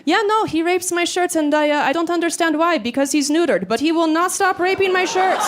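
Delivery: imitating the date insisting in his European accent; thick European accent. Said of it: "he had this really thick European accent".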